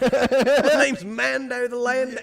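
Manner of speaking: slow, high hammed-up Aussie; mock Australian accent. Joining the Aussie bit with his friend, slow mock Australian. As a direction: drag it out in a silly Australian voice. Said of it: "Was he Australian now?".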